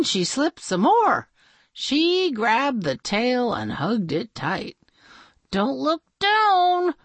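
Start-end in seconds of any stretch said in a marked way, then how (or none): 5.5-6.9 high, slow self-warning; lightly voiced princess talking to herself; cartoon princess. A narrator's reading. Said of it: "she told herself".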